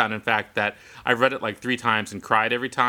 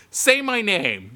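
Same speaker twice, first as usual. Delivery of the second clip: slow, higher-pitched demand; drawn-out, raised-pitch command; insistent demand; 9 semitones higher, slower than usual. Retold or imitated. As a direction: stretch it out as an order. Calling sister, demanding she say it.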